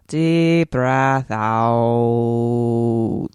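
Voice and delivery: very slow, low, long-held breathe-out; breathing cue. Pretend. Host lets the accent go, drawn-out low breathing cue. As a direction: sink and stretch the last word like a long exhale. Guided exhale after dropping accent.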